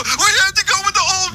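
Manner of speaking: high, whiny mock sob of a scared pundit; mock crying Democrat. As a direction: whimper it in a high, pathetic voice. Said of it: "keep crying in a corner and go".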